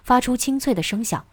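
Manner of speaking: plain reading voice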